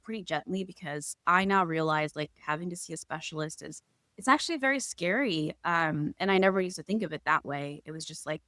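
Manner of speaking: ordinary delivery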